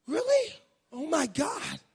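gasp, then high, loud disbelief; hammed-up astonished reaction; mock shock. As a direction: act scandalized with a high-pitched outburst. Acts out mock shock at remark.

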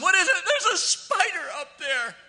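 very high, loud spider alarm; hammed-up high-pitched scream of alarm; mock panicked shriek. Acts out daughters' spider scream.

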